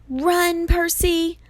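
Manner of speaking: high-pitched urgent cry; urgent warning call. A narrator's reading. Annabeth urges Percy to escape, calling out high. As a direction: call out to make someone flee.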